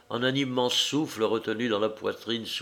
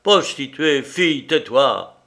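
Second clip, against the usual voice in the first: short, louder insulting outburst; insulting bark; 4 semitones higher, 6 dB louder than usual. Acted. Humiliated man lashing out at Liuba, louder and sharp. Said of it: "lança des paroles brèves et insultantes".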